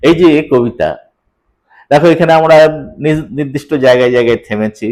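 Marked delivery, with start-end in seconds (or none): none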